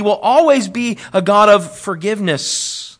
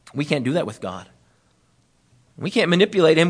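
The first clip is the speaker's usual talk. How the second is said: normal talking voice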